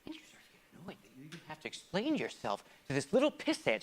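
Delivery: usual speaking voice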